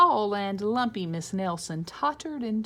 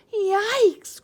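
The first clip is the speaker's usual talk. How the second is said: much higher, much louder yelp; frightened cry; 14 semitones higher, 10 dB louder than usual. Acted. Kids seeing the witch, high loud cry. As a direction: squeal it like scared kids. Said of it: "cried the kids".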